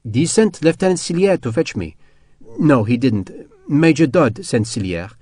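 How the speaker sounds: put-on French-accented woman, measured; French wife's accent. French wife explaining who sent her escort, in a French accent. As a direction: correct yourself in a French accent.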